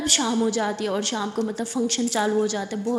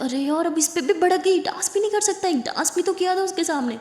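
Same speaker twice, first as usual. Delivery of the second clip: higher, whiny mock complaint; exasperated complaint; 7 semitones higher than usual. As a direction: play the fed-up fiancé, pitched up. (جھنجھلائی ہوئی اونچی شکایت)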